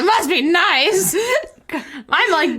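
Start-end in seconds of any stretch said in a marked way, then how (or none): 0.0-1.1 slow, drawn-out, higher mock envy. Shown as a demonstration. Rereading the line to her cohost, slow and mocking. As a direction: stretch it out like a jealous sigh. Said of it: "I don't know why I said it like that".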